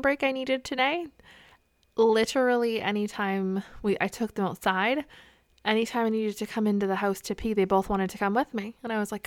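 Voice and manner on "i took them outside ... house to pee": usual speaking voice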